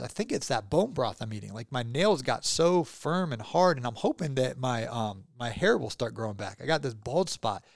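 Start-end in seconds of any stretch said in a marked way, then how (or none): none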